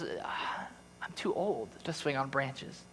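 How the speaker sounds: slow whispered, worn out; tired whisper. Acted. Aged boy telling the tree, whispered and weary. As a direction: breathe it out like a frail old man.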